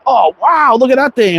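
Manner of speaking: put-on high, excited kid voice; imitating his son. Son sees the new slingshot, imitated excited kid. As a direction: mimic a thrilled young boy. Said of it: "my son comes out and he's like".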